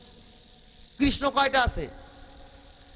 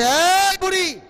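calling out; 5 semitones higher, 7 dB louder, much slower than usual. Acted. Old man calls his wife.

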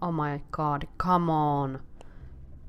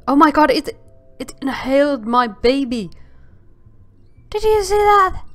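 loud, higher shocked exclamation; shocked outcry; 8 semitones higher, 10 dB louder than usual. Real, not acted. Player watching a fish eat her baby, loud shock. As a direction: cry out in real disbelief.

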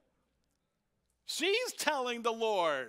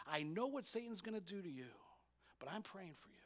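softer, quick and intense, fading away; tense, hushed; 9 dB quieter, faster than usual. Retold or imitated. Jesus warning Simon, hushed and tense. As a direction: press it close and urgent. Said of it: "isn't that a tense emotion?".

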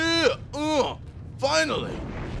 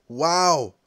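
ordinary talk

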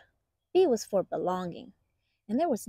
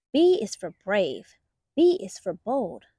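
plain reading voice

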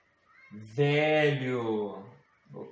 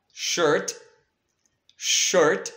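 ordinary talk, nothing marked

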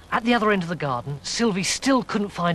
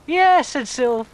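teasing high voice; 8 semitones higher, 4 dB louder than usual. Acted. Sylv teases Mary.

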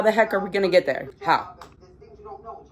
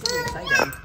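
excited squeal. Real, not acted. Opening packages.

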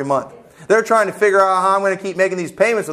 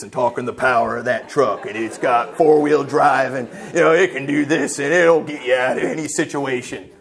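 a lower put-on pitchman selling power; truck ad guy voice; 5 semitones lower than usual. Preacher mimics a truck ad narrator in a lower macho voice. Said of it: "some guy talking like this".

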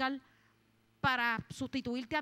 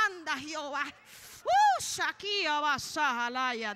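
overcome with fervour; 2 semitones higher, 4 dB louder, much slower than usual. Real, not acted. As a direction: cry out in tongues, slowing on the syllables. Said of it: "yo siento la presencia de Dios".

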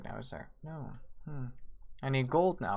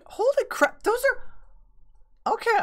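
much higher and louder, thrilled gasp; excited shock; 6 dB louder, slower than usual. Real, not acted. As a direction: blown away by the price. Seeing the big price.

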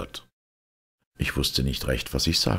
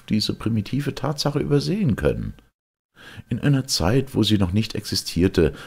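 lightly voiced, higher-pitched time traveller; a touch of agitation, pitched up; agitated time traveller; 9 semitones higher, 4 dB louder, faster than usual. A narrator's reading. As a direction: explain it with exasperated urgency. Time traveller explains his predicament.

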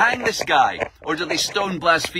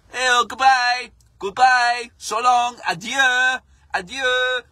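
high, loud cheery mock farewell; mock French police farewell; 8 semitones higher, 5 dB louder, slower than usual. French police cheerily waving off the boats, hammed-up farewell. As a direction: wave them off like a jolly gendarme.

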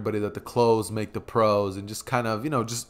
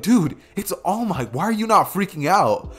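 higher, incredulous classmate; high-pitched fan astonishment; freaking out; 7 semitones higher, 3 dB louder than usual. Acted. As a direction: stretch it out in amazed disbelief. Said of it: "and they're like".

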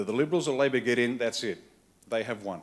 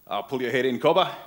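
laconic pub bloke; 2 semitones higher, 8 dB louder than usual. Retold or imitated. A pub drinker telling a loudmouth to pipe down, gruff. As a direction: play the unhurried Aussie bloke at the bar. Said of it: "he'd look up and go".

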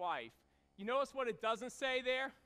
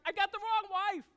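higher and louder, shocked; shocked realization; 9 semitones higher, 7 dB louder than usual. Retold or imitated. Jacob realizing he married the wrong wife, shocked and loud. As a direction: blurt out the shock of the wrong bride. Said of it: "He's like".

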